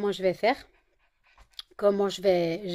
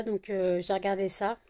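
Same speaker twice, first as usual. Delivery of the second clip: normal talking voice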